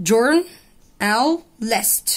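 journalist drawn out very slowly, jour-na-list; syllable by syllable. Shown as a demonstration. Teacher breaking a word into syllables for students, very slow. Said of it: "فبص كلمة farm اتقسمت far ومر".

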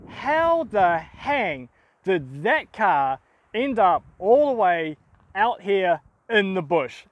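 ordinary delivery

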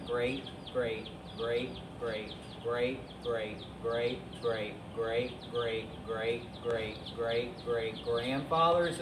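low, soft monotone repeating; droning repetition. Pretend. Host tells kids about chicken ancestors, droning repetition. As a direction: drone on through an endless list. Comic endless list of greats.

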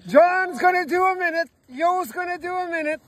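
high loud excited announcement; loud announcing. Real, not acted. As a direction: announce it to the crowd. Announcing jumper at ice hole.

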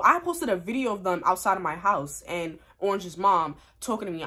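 ordinary talk, nothing marked

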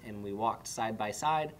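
ordinary delivery

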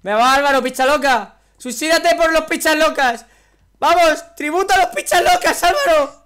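high, loud mock begging; hammed-up loud chant to a player; loud pleading. Real, not acted. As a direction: beg him at full volume. Loudly begging a player to jump.

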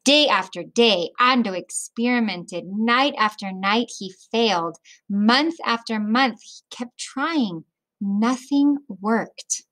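put-on reading a picture book aloud to children; children's story read-aloud; storybook narration. A narrator's reading. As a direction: tell it gently, like story time.

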